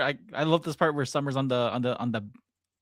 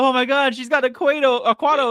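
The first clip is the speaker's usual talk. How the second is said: mock shock; 9 semitones higher, 5 dB louder than usual. Acted crowd's shocked reaction.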